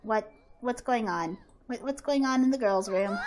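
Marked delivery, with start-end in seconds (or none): none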